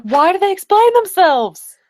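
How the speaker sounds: high, pleading disbelief; mock-anguished complaint; exasperated. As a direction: cry out at the absurdity. Retold exasperation at book villains.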